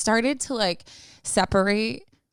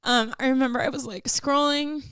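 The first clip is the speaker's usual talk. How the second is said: crying voice; 6 semitones higher than usual. Real, not acted. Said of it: "this is, like, my crying voice".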